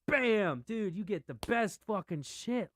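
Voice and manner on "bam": mock bam. Imitating a Hulk punch.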